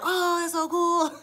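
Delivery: put-on high, slow wide-eyed kid's wonder; childlike drawn-out awe. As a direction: say it like a kid amazed by fireworks. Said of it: "I was like a kid".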